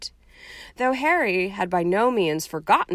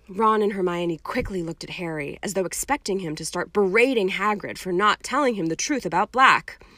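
ordinary delivery

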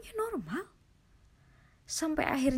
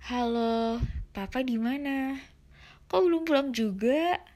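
higher, louder anxious wife on the phone; worried wife; 4 semitones higher, 5 dB louder than usual. Retold or imitated. Wife phones her late husband on the road, anxious and louder.

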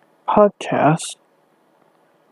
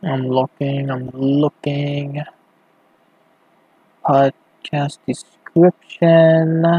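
slow, stretched-out words while searching; drawn-out searching; much slower than usual. Real, not acted. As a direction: drag out each word absentmindedly. Stalling while searching the page.

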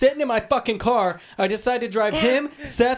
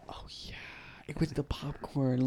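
whisper; 6 semitones lower, 12 dB quieter than usual. Real, not acted. Quiet aside about the popcorn.

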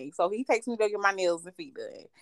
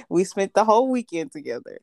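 usual speaking voice

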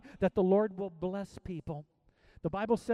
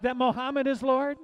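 ordinary talk

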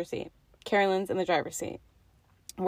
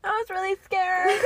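mock scared; 11 semitones higher than usual. Real, not acted. Mock fear after car passes.

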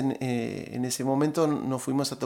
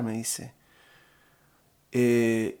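normal talking voice

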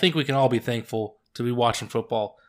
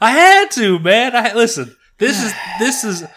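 excited through laughter; 9 semitones higher, 8 dB louder than usual. Real, not acted. Defending Lions pick laughing.

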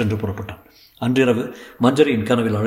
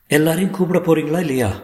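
higher, sharp demand; high-pitched scolding; 6 semitones higher than usual. A narrator's reading. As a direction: scold him in a raised pitch. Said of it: "உச்சக்குரலில் அதட்டினாள்".

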